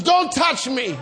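loud, high warning to back off; angry yell. Retold or imitated. Wife to husband rubbing her back, angry yell. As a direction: act out a laboring wife's outburst.

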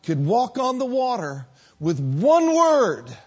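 impassioned pulpit preaching; fiery preaching. Acted. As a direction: preach it full force to the congregation. Preaching at full force.

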